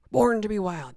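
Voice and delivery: loud happy recognition; excited. Real, not acted. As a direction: blurt the title out gladly. Recognizes song title.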